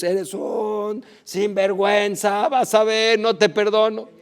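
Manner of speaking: half-hearted angry scolding at a thief; mock angry yell. Preacher imitating how he would scold the thief, mock anger. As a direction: play the petty angry man chasing his thief. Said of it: "Yo lo que hubiera hecho es".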